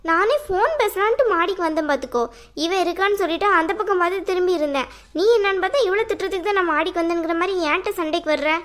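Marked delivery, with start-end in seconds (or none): none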